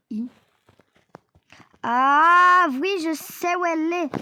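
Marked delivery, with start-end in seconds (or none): 1.8-4.1 loud ah of remembering; sudden realization. Real, not acted. Gamer remembering where the safe is, sudden loud ah. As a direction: it just came back to you.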